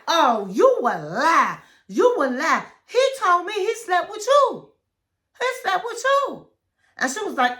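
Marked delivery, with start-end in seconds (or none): 0.1-6.5 rising higher and louder, insistent; mock heated insisting, climbing high; heated accusation. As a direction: build to a shrill accusation.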